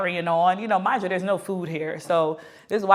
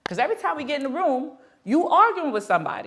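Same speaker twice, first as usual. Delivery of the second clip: high-pitched retold complaint; exasperated nagging; 8 semitones higher than usual. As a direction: pile up the accusations, rising higher. Retold scolding of boyfriend.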